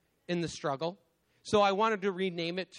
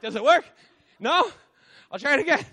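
frantic trying; 8 semitones higher, 11 dB louder, faster than usual. Retold or imitated. Flipping switches in flooded basement.

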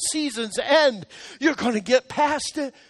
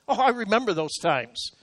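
usual speaking voice

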